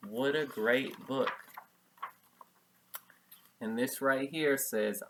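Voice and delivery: plain reading voice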